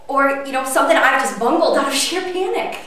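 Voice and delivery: building panic, pushing harder; rising agitation. Acted. Anxious confession to a difficult boss.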